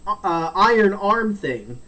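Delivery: normal talking voice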